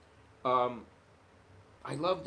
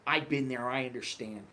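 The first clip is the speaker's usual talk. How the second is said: easygoing cop; 4 semitones lower, 4 dB quieter than usual. Retold or imitated. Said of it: "the guy was in a great mood and he's like".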